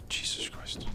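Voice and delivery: breathless whispered exclamation. Real, not acted. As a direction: gasp it under your breath.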